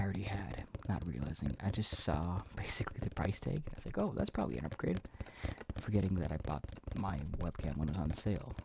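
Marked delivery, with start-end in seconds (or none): 4.0-5.0 naive self-quote. Retold or imitated. Quotes own naive earlier thought.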